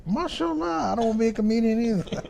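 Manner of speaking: very high, fast put-on voice; comic squeaky voice. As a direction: squeal the punchline like a cartoon. Dad's comic punchline reply.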